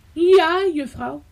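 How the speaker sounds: high-pitched little pupil's answer; obedient little girl. A narrator's reading. Rika obeys the teacher, little girl's voice.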